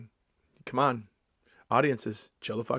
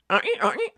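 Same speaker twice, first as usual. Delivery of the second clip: high, loud honking noise; horn toot; 5 dB louder than usual. Pretend. Comedian tooting his own horn, imitated honks. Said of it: "gonna toot my own horn real quick".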